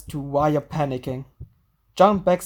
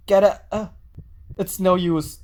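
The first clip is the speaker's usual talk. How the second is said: ordinary talk, nothing marked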